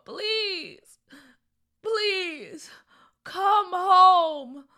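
high, drawn-out pleading calls; hopeless calling. A narrator's reading. Father searching for his lost children, hopeless. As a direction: call out slowly, losing hope. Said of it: "the voice said without any hope".

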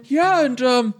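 a happy kobold saying they made it, man; laid-back kobold. Acted. Kobold crowd speaks.

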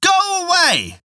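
very high, slow desperate cry; old woman crying out. A narrator's reading. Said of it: "she cried out loudly".

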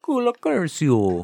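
Cola Curcio high, loud and slow, Italian mob style; mafia don voice. Retold or imitated. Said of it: "You come with me on my daughter's wedding day".

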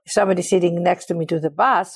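normal talking voice

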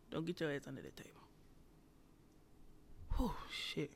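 fast soft whisper; quick hushed whisper. Real, not acted. Whispered warning then relief.

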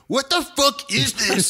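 very high, slow, loud yell; imitated furious roommate. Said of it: "he was really mad".

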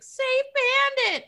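very high, drawn-out mock shriek; imitated screaming. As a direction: shriek it like a panicking TV character. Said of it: "the innkeeper's wife was screaming like that".

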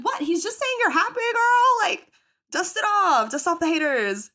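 high, loud cheerleading; sassy kid hype. Retold or imitated. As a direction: hype them up like a sassy child. Child cheering bullied classmate.

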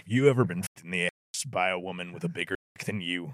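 very low, soft pitchman voice; Sam Elliott. Acted. Host mocking truck ads, low Sam Elliott impression. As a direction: deliver the crude line as a low cowboy ad. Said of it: "people doing the Sam Elliott impression".

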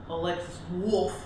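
stuffy voice from a cold. Real, not acted. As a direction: talk through a head cold. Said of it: "I got a cold".

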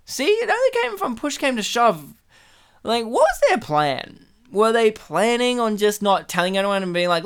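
usual speaking voice

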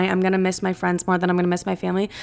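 ordinary talk

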